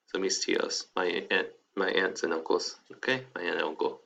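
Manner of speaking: ordinary talk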